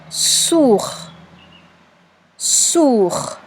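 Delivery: "sourd" enunciated for spelling; dictated syllable. Shown as a demonstration. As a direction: dictate "sourd" slowly with a long s. Teacher dictating a syllable twice.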